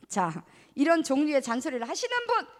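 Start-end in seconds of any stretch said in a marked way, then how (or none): none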